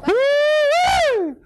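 high, loud joyful holler with a laugh; delighted whoop. Retold or imitated. Adam whooping in delight at first sight of Eve. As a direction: whoop like a man who just saw his bride.

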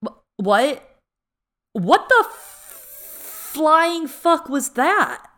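high, slow, stunned outrage; outraged disbelief. Real, not acted. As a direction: drag it out in disbelief.

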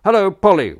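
high, loud cheery greeting; imitating Horace. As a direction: chirp it like a chummy giant. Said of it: "were his exact words".

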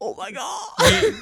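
high gasp, then slow "oh my God"; shocked gasp. Real, not acted. Shocked at crude joke.

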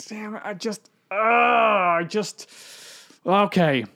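high, halting, stammering anger; frustrated outburst. Retold or imitated. Hero hearing his father was kidnapped, halting high frustration. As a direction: sputter it out, barely holding it together.

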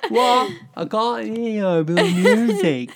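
very high, loud, giggling yell; shouting over party music while laughing. Pretend.

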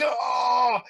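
high, loud delighted "Oh!"; excited shout. Retold or imitated. Father and son in theater spot the actor, shouting. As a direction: cheer as the hero appears. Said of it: "we're like".

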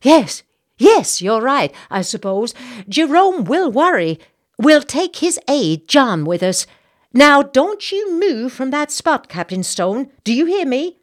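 high, loud, commanding matron; excited society lady giving orders; bossy Southern lady. Acted. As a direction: order him to stay put. Missus Bartlett orders the captain.

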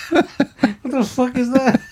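high, slow, helpless laughing voice; laughing hard. Real, not acted. Host acting out surprise at the macaw, laughing helplessly. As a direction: squeeze the words out through laughter. Cracking up at the parrot joke.

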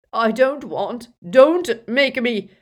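loud, high, drawn-out plea; frightened. A narrator's reading. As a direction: unrecognisable with fear, begging. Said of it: "never heard Dumbledore frightened like this".

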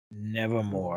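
low, soft, ominous quote; quoting the Raven. Acted. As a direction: drop low and quiet for a mock-solemn quotation. Quoting the Raven after announcing it.

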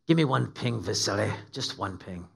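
low, lightly voiced Scottish-Russian sea captain; Scottish-Russian accent impression. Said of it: "a Scottish Russian accent".

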